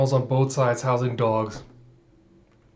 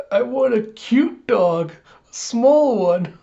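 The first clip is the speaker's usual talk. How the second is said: put-on high, pleading little-girl whine, slowing at the end; whiny crying; 8 semitones higher, slower than usual. Anya, crying to her parents, begs for a small cute dog in a high whine. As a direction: whimper for a cute little dog like a tearful child. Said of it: "Anya's just crying".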